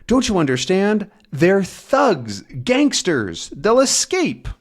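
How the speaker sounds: high, pleading and urgent; urgent plea. Acted. Tintin pleading with the detectives, high urgent voice. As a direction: beg to be let go, pitched high. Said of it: "And then he says".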